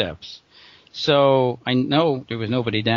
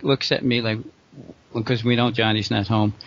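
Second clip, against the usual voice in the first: ordinary talk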